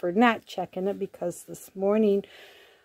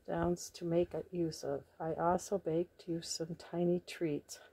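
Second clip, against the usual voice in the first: reading a card softly; 4 semitones lower, 13 dB quieter, faster than usual. Real, not acted. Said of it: "reading the card aloud".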